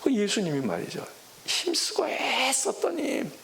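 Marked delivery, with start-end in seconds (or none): 1.5-3.3 strained, drawn out. Real, not acted. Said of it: "preacher dwells on Jesus' agony".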